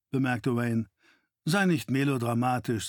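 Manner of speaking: ordinary delivery, nothing marked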